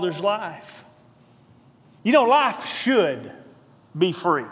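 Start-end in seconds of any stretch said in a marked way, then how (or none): 2.2-4.4 slow, drawn-out emphasis on "should"; stress on SHOULD. Shown as a demonstration. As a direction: lean on "should", pause, then drop lower on "be free". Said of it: "Hear the emphasis".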